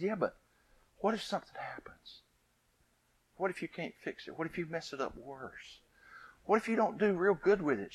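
put-on high, hushed child's worries; worried little girl. Scene: little girl fears mama will ruin the shoe, hushed and high. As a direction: whisper her fears like a small girl.

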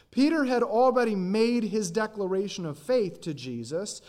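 ordinary talk

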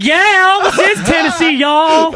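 high, loud Southern twang; hammed-up Tennessee drawl; Southern accent. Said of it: "They're probably like".

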